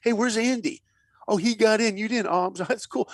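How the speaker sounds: high, sing-song mock sympathy; mocking classmates. Schoolmates needle the kid who didn't get in, high mock pity. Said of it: "they're like".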